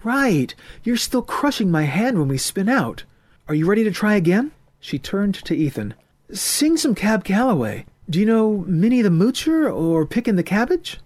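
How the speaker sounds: put-on a confident swing girl setting terms; young woman's voice. A narrator's reading. Heidi's voice in the story.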